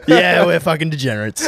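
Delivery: laughing through the words, voice jumping high. Real, not acted. Cracking up with his mate over their party life, laughing. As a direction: burst out laughing as you agree.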